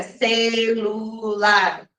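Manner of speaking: slow, syllable by syllable; syllabified. Shown as a demonstration. Teacher showing the wrong way to dictate, spelling it out slowly. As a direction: split the word into syllables slowly. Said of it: "Você não vai falar silabado".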